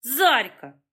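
ordinary delivery

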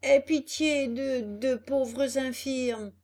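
slow, high begging whine; hammed-up plea for pity; plaintive. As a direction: drag it out like a pitiful beggar. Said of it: "cria le renard d'une voix plaintive".

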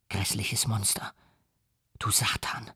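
soft, breathless whispered insult; a voice sunk to a whisper of loathing; whispered curse. A narrator's reading. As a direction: spit the words out in a whisper, barely voiced. Creator curses his monster.

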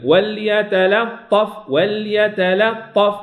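وليتلطف with a short hold on the lam; slow tajweed demo. Shown as a demonstration. Teacher shows the pause on the middle lam, slow recitation. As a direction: linger briefly on the lam in وليتلطف. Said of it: "أقف قليلًا عند اللام البينية".

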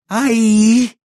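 high, loud drawn-out cry; insistent old woman. A narrator's reading. Old woman insists, pointing at the heap, high loud cry. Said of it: "insistió la mujer".

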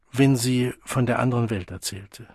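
ordinary delivery

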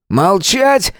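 high-pitched, commanding shout. Acted. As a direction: silence the room with one sharp order. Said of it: "закричал Дмитрий".

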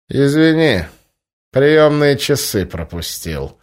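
biting, slow sarcasm; mock him with venom; sarcastic. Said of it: "язвительно ответил".

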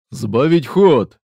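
loud, slow battle command; shouted order. Acted. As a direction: bark the order over gunfire. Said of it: "приказал наёмник".

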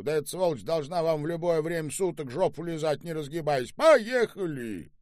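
high, rushed rant ending in a shout; indignant rant. Acted. Boss rants about a debtor and orders departure, indignant. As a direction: rattle off the outrage, then call out the order.

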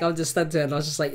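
normal talking voice

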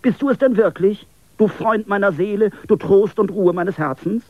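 high, loud, overjoyed reunion cry; joyful call. A narrator's reading. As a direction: call out in delight at seeing your friend alive. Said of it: "rief erfreut".

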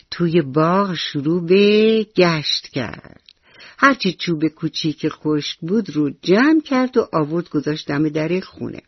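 ordinary delivery

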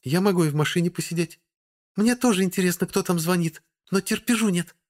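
put-on high, fidgety young woman; can't wait any longer; impatient girl voice. A narrator's reading. Vasilisa impatient.

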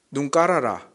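short, lightly voiced Gyeongsang dialect. Preacher giving the blunt version of a sketch line, Gyeongsang dialect. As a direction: say it curtly in mild Gyeongsang dialect. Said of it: "경상도 사투리로는".

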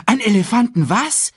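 high, loud curious cub; astonished question. Acted. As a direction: blurt out your surprise. Simba astonished by the slip.

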